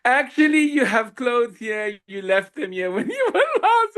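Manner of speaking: calling out from inside the house, laughing; retold scream. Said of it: "Shefali's mom screamed from inside".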